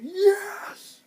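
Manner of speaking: very high, loud exultant yell; retold triumphant cheer. Fan reliving Ghost's attack, high exultant cheer. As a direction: let out a soaring fan's cheer.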